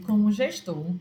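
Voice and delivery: ordinary delivery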